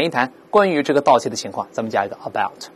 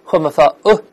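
ordinary delivery

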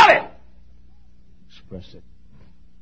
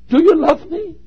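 pleading cry; 12 semitones higher, 3 dB louder than usual. Acted. God's question, cried out.

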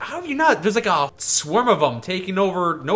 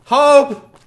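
loud call of a name; 7 semitones higher, 9 dB louder than usual. Real, not acted. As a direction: shout her name. Calling out to companion.

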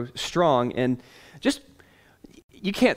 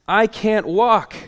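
raised, drawn-out voice of a lame man; lightly voiced lame beggar, slow; lame man's complaint; 7 semitones higher, 4 dB louder, much slower than usual. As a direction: act out a lame man's slow, higher protest. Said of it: "they run across a lame man".

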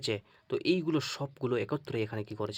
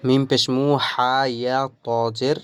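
much louder drilled spelling; 11 dB louder, much slower than usual. Shown as a demonstration. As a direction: spell it out very loudly, like calling to a class. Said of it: "spelling out loud".

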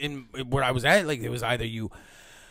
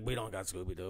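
muttered aside; 7 semitones lower, 14 dB quieter than usual. Retold or imitated.

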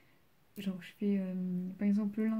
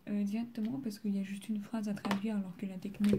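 ordinary talk

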